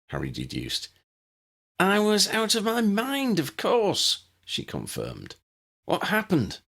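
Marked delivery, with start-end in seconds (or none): 1.8-4.2 much higher, emphatic worried wife; high and fretful; Ginny's voice. Acted. Ginny tells Harry her worry, high-voiced. 5.8-6.6 higher, anxious question; high and concerned; Ginny's voice. Acted. Ginny asks Harry, higher and anxious.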